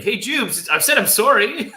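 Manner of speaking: high-pitched, exaggerated cartoon apology; mock pleading. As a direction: act out a loud, high apology, half laughing. Acted plea in retold scene.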